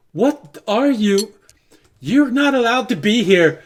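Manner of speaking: high, loud, indignant challenge; Sears. Acted. Catching someone at the intercom, alarmed scolding. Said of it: "you hear the voice of Sears".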